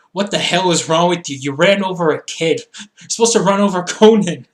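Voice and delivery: loud retold scolding; mock indignation. Imitating a wrestler scolding his crew for hitting a kid, mock indignant. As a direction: take them to task, half in jest.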